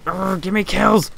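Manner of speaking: fed-up groan at stolen kills; frustrated. Real, not acted. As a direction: whine it out in exasperation. Frustrated at assists only.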